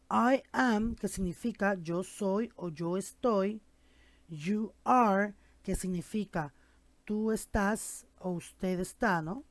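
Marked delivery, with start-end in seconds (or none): none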